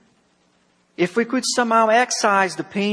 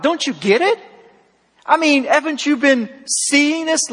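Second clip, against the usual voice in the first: sarcastic; 7 semitones higher than usual. Retold or imitated. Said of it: "he says sarcastically to God".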